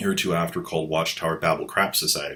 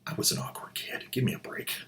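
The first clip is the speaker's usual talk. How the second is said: much softer, sheepish whispered aside; embarrassed whisper; 11 dB quieter, faster than usual. Real, not acted. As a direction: mutter it under your breath, a bit embarrassed. Embarrassed aside after being called nerd.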